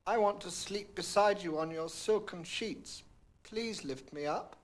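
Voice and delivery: a small creature pleading; frog's voice. A narrator's reading. Frog asking the princess to lift him up, mild plea. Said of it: "he said".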